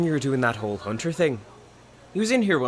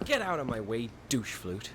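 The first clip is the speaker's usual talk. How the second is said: rude dismissal; 3 semitones lower, 9 dB quieter than usual. Acted. Styx insulting the assistant manager.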